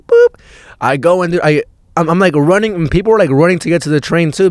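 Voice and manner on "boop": high, loud imitated beep of the turnstile; card reader beep. Tapping the card at the subway gate, a high electronic boop. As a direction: chirp it like a Metro card reader. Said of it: "I do the little Metro card thing".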